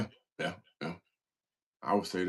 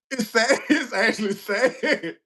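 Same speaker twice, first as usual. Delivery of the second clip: high, laughing through the words; giggling while insisting on it; 10 semitones higher, slower than usual. Real, not acted. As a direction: say it through real helpless laughter. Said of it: "And I'm laughing now".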